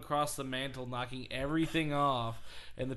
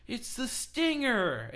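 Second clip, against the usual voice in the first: put-on high, drawn-out kid's yell; excited kid; 11 semitones higher, 5 dB louder, slower than usual. Kid announcing Sting at the door, excited. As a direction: cry it out like a thrilled little boy. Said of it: "opens the door and he's like".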